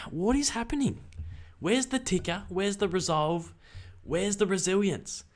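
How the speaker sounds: high-pitched, incredulous demand; exasperated pleading. Retold or imitated. Fan at the TV asking where the resolve is, exasperated. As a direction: re-enact a fan's exasperated plea, pitched high. Acts out his exasperation mid-game.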